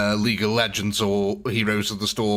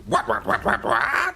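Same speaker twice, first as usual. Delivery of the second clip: goblin voice. Acted. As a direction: chatter like a cheeky goblin. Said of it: "say your name in that goblin voice".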